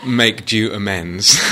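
slow, loud, through laughter; laughing. Real, not acted. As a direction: draw it out with a laugh. Joking, laughter around it.

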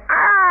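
mock frustrated scream; drawn-out loud "aah" of a tantrum; Calvin. Calvin running in circles without snow, frustrated scream. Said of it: "He's really frustrated".

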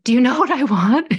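high, loud and bright with eagerness; eager question. Retold or imitated. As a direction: ask it like you can't wait to tell. Client's eager question voiced.

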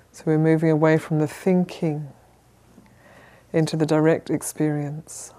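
calm, measured meditation guidance with long pauses; unhurried, even instructions, leaving space to settle; guided meditation. Real, not acted. Teacher settling a group into sitting posture, calm guided meditation.

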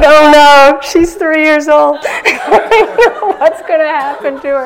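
loud, high mock horror through laughter; laughing alarm. Real, not acted. Teacher recalling her worry about the niece, laughing loudly. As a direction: laugh helplessly while pretending to worry.

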